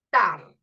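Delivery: "Tar" punched loud and low; stressed syllable. Shown as a demonstration. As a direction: hit "Tar" hard as one isolated syllable. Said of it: "Ahí es donde suena más fuerte". ("Tar" fuerte, aislada y marcada)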